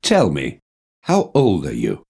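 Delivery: normal talking voice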